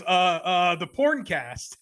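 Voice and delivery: slow, drawn-out intro flub; botched intro. Retold or imitated. Cohost fumbling the podcast intro, slow and halting. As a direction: drag out the porn cast like a nervous newbie. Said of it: "And he goes".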